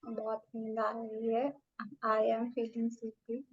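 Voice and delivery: very slow drowsy repetition; slow sleepy repeating. Shown as a demonstration. Student repeating the sleepy mantra, very slow. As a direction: drag each word as if half asleep. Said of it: "अपने आप को ये बात कम से कम दस बार बोलो".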